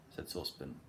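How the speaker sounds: plain reading voice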